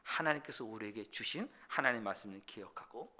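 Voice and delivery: usual speaking voice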